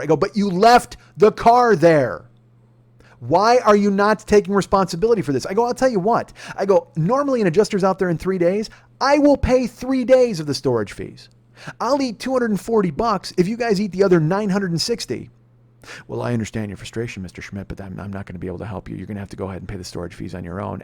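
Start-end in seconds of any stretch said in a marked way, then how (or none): 0.2-15.3 high, loud insistent bargaining; retold angry demand. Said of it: "I'm louder". 16.1-20.8 low, soft, unbothered agent voice; customer-service drone brushing off an angry customer; bored insurance agent. Retold or imitated. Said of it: "ho-humming the shit out of me".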